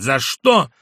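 shouted. A narrator's reading. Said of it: "крикнул Иван Дмитрич".

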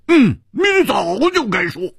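high, squeezed, emphatic retort; impatient rebuke. Acted. Hu telling Liu she should have confessed, impatient.

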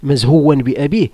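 ordinary delivery

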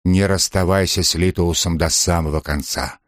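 ordinary delivery, nothing marked

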